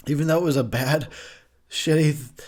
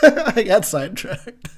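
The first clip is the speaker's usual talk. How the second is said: laughing; 11 semitones higher, 5 dB louder, slower than usual. Real, not acted. Laughing at own tangent.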